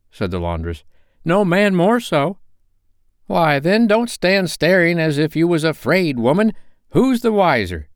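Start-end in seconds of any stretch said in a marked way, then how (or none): 1.2-7.9 put-on high, loud defiant old woman; cheeky old woman daring the others; bold charwoman. A narrator's reading. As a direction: brazen charwoman, defiant and raised. Voiced women.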